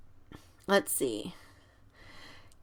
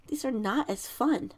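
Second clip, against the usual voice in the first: ordinary talk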